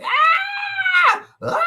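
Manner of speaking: high, loud mock yelp; retelling Steve Harvey's joke to Cynthia, mock yelp; imitating a yelp. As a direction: squeal it out like the joke's punchline. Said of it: "the funniest joke that Steve Harvey ever told".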